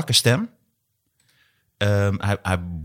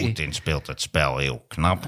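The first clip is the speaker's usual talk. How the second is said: lower, slow grumpy drone; Maarten van Rossum impression; 8 semitones lower, 6 dB quieter, slower than usual. Shown as a demonstration. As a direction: show it lower and slower. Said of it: "hij toch wat lager moet".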